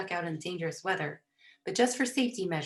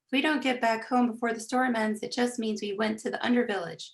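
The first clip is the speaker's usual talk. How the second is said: higher, raised calling voice; 5 semitones higher, 4 dB louder than usual. A narrator's reading. Dad calling out where they are going, raised voice. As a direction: project it to another room. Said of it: "Dad called out".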